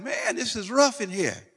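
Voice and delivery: high-pitched acted complaint; hammed-up prisoner's moan; mock moaning. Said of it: "And we're moaning".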